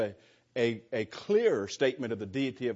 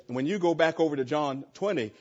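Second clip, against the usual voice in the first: ordinary delivery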